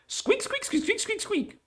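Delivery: rapid-fire string of very high squeaks; squeaking. Acted. Voicing squeaking creatures in the storyboard, high squeaks. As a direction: squeak like an excited little animal. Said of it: "A bunch of squeaking and ribbiting".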